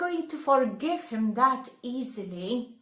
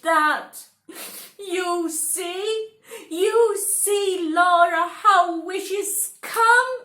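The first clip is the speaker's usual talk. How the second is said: higher, louder sing-song apology; sorry wife; 8 semitones higher, 5 dB louder than usual. Acted. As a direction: plead forgiveness.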